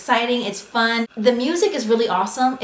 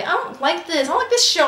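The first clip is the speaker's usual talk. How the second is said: mock angry whine; 8 semitones higher than usual. Telling her husband she hates the show, high whiny anger. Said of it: "I was like".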